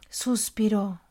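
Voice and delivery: ordinary delivery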